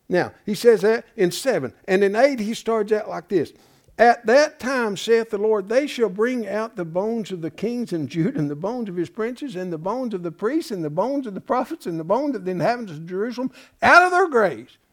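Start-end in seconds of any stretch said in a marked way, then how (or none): 4.0-14.8 higher, intoning proclamation of the verse; scripture reading. Acted. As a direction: preach the bones brought out. Reads scripture aloud.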